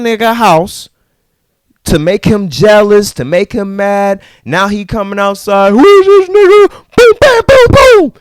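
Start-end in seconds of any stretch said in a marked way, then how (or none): 5.7-8.1 much higher, louder yell and gun noises; imagined gunman hammed up; mock shootout. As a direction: burst in with the shots.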